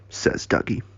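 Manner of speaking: ordinary delivery, nothing marked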